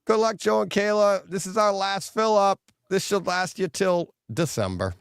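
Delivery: high, sing-song teasing daughter; lightly voiced daughter rubbing it in; mocking daughter. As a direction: read it back to him with glee. Daughter reads old text mockingly.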